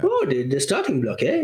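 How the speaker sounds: lightly put-on French accent. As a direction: imitate a listener with a hint of French. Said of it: "I got a French accent".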